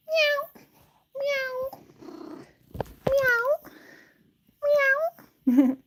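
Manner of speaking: short, high little meows; imitated cute meow. Showing Stratus's cute boy meow, small neat mews. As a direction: mew like a sweet little cat. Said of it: "perfect little cute, cute boy meow".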